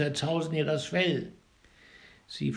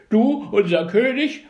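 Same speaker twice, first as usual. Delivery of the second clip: higher, much louder outraged cry; angrier birds; 7 semitones higher, 10 dB louder than usual. A narrator's reading. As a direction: shout it in fury. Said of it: "riefen die Vögel noch zorniger".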